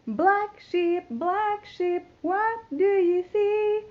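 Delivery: plain reading voice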